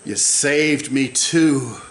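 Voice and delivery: low, slow devotional murmur; hushed prayerful preaching. Real, not acted. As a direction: bring it down to an intimate prayer. Quiet slow worship after climax.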